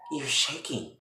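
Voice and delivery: very soft, sinking low; quiet menace. Retold or imitated. Catherine pressing tea on shaking Veronica, quietly menacing. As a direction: offer it softly like a veiled threat.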